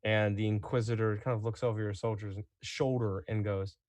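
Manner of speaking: ordinary talk, nothing marked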